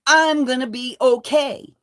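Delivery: ordinary talk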